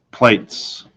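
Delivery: ordinary delivery, nothing marked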